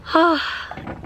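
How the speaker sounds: long breathy sigh of relief; relieved sigh. Real, not acted. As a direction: let out a tired sigh. Relief after headache.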